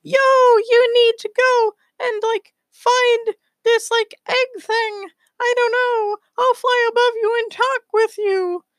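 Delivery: very high squeaky cartoon voice; Space Squirrel. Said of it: "and he's like".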